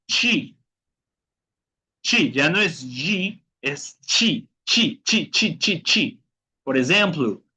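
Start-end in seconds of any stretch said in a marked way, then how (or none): 0.1-6.2 "chi" with a ch sound, pitched up and repeated. Shown as a demonstration. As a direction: drill "chi" as short falling syllables. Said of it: "T más I". ("chi" repetido, con sonido de ch)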